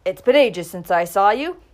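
declared loudly, teasing; loud declaration. A narrator's reading. Udell teasing Clara about her absence, loud declaration. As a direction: boom out the greeting. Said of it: "he declared".

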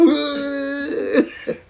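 loud, high retching noise; exaggerated hurling sound; mock retching. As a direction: imitate someone throwing up, high and drawn out. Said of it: "sounded like he was throwing up".

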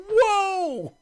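high-pitched, loud "whoa" imitating startled viewers; mock shocked reaction. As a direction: mimic listeners blasted by the volume. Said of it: "there'd probably be some people like".

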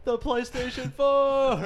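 very high, slow drawn-out announcement; dramatic reveal. Retold or imitated. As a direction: stretch it out like a big reveal. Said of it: "He goes".

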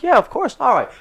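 high-pitched mock politeness; agreeable customer. Customer agrees to hear the pitch, high and obliging. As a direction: imitate an easily won-over customer.

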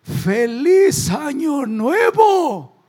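high, very slow, hammed-up old man yelling; old man's shout. Grandpa in the rocking chair shouting to his family, hammed-up and slow. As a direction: belt it like a confused grandpa. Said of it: "Y el viejito dice".

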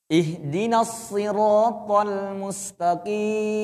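long drawn-out Quranic chant; slow recitation. Shown as a demonstration. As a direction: recite each sound slowly and evenly.

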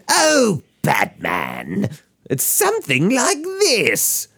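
a sly, high Joker drawl. Shown as a demonstration. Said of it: "It's not very good".

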